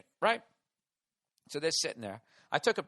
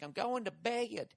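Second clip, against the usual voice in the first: Dallas drawl; 7 semitones higher, 5 dB quieter, slower than usual. Shown as a demonstration. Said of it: "You know how they do that in Dallas?".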